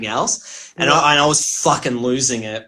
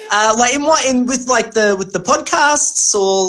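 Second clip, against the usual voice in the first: high-pitched and giggling through the words; bashful laughter while asking for more; laughing; 11 semitones higher, 5 dB louder than usual. Real, not acted. Guest fishing for compliments from host, laughing high.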